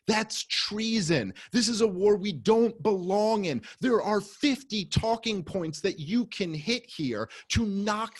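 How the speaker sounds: reliving the rant, yelling every point up high; retold screaming. Comedian yelling at Gary Johnson in the green room, furious high shout. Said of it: "and I'm screaming at him".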